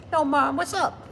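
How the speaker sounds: high parrot voice greeting mom; talking parrot. Retold or imitated. Pet parrot greeting the mom, high and squawky. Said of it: "if a parent just said".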